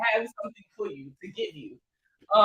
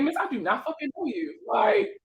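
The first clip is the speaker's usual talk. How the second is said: louder, higher mock outrage; incredulous denial; 7 semitones higher, 4 dB louder than usual. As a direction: deny it loudly, half joking. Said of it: "I'm looking at her like".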